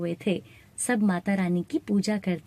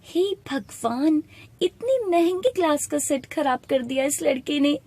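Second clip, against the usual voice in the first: higher-pitched, mildly exasperated outburst; angry complaint; 5 semitones higher than usual. Acted. Shopkeeper's wife at a broken glass, mildly angry. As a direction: lament a breakage with a touch of anger. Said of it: "वो गुस्से में".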